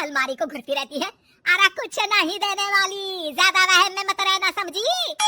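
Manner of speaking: loud, shouted tirade; overbearing mother-in-law ranting; angry scolding. Acted. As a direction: throw her out with a furious yell. Mother-in-law angrily orders her out.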